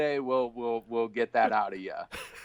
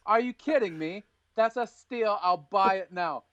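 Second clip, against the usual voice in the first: much higher, mock English buyer; awful English accent; 9 semitones higher than usual. Co-host imagines a buyer chiming in on the call, awful English accent. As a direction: chime in with a hammed-up English accent. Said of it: "awful English accent right there".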